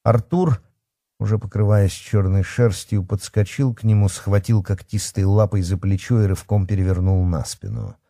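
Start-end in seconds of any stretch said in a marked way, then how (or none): none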